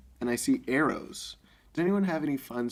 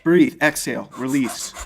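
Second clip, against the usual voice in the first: coaching command; 8 dB louder than usual. Acted. Father coaching a bow shot, louder.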